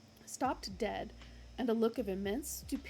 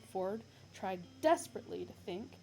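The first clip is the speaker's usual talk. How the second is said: plain reading voice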